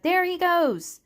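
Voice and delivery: high, loud call across the street; a bystander shouting as the carriage escapes; shout from the crowd. A narrator's reading. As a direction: yell it out after the fleeing carriage. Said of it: "Someone in the crowd ... the church shouted".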